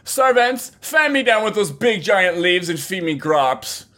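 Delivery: high, imperious call to servants; slow, lordly command, mock-grand; hammed-up pampered mistress. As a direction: order your servants about with lazy grandeur. Hammed-up mistress ordering servants about.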